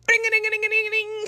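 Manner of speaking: high sing-song ringtone imitation; phone ringtone. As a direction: chirp it out like a ringing phone. Imitating her phone ringing.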